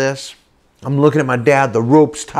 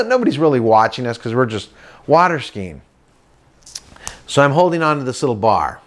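ordinary talk

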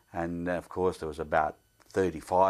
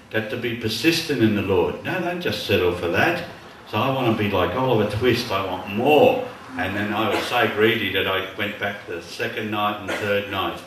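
loud, fast, driving preacher's voice; preaching at full force; 8 dB louder than usual. Acted. Preacher urging the congregation to persist, loud and driving. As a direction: drive the point home to the congregation.

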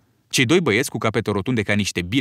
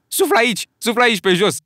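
eager coaching; 10 semitones higher, 4 dB louder than usual. Acted. Piggy coaching Ralph eagerly.

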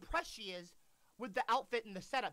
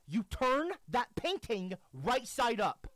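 each word pressed, slow; serious slow order to creator; slow stern emphasis; 3 semitones higher, 5 dB louder, slower than usual. Real, not acted. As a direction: lay down the law word by word. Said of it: "I'm serious".

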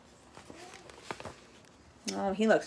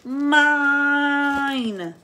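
drawn-out, rising cry on MINE; greedy bee claiming it, acted; possessive story shout; 3 semitones higher, 3 dB louder, much slower than usual. A narrator's reading. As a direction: stretch MINE into a long held shout. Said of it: "he shouted".

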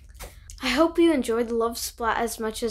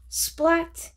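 sudden, higher and louder exclamation; emphatic splat; 6 semitones higher, 5 dB louder than usual. A narrator's reading. Story reader sounding out a bump, emphatic. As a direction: punch it out like a collision.

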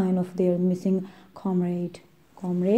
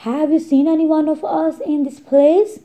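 higher, louder worried asking; pleading question; 8 semitones higher, 5 dB louder, faster than usual. Acted. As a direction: beg the shepherd for news.

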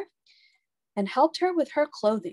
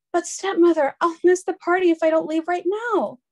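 put-on high, louder girlish plea; pleading girl; 8 semitones higher, 4 dB louder than usual. A narrator's reading. Cinderella begging her stepmother to let her go, high and pleading. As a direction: beg not to miss the party. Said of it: "said Cinderella".

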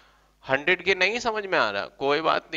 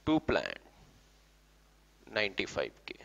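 soft, low murmur to oneself; near-whispered note to self; murmuring while writing; 6 semitones lower, 8 dB quieter, faster than usual. Real, not acted. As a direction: say it under your breath as you write.

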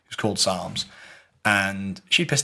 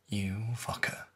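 muttered curse; 11 dB quieter, much slower than usual. Retold or imitated. Retold muttered reaction to blame.

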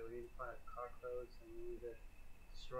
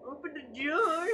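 much higher, loud demand falling; loud yell; 13 semitones higher, 12 dB louder than usual. Real, not acted. Streamer yelling the door order at the ghost. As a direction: shout the order.

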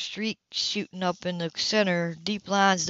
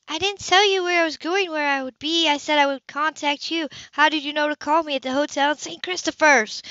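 higher, insistent questioning sister; Sybil, pressing; 8 semitones higher, 3 dB louder than usual. Acted. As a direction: push her for the truth.

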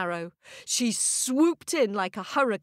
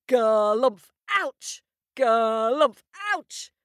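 giant's cry of pain; 3 semitones higher, 4 dB louder than usual. Acted. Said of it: "went the giant".

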